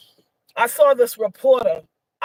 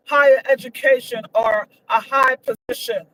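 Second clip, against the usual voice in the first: ordinary delivery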